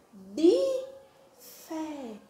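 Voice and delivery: bi and fé held apart; syllable by syllable. Shown as a demonstration. Teacher sounding out two syllables, Bifé slowly split. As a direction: leave a gap inside Bifé, open final é. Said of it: "a sílaba bi e a sílaba fé".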